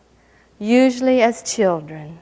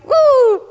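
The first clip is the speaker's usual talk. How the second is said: high, louder yelp of alarm; startled woo; 4 dB louder than usual. Retold or imitated. In the car, nearly hitting the oncoming vehicle, high startled woo. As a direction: let out a high swerving woo. Bracing as the car nears collision.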